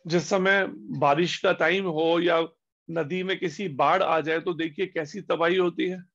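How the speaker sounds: ordinary talk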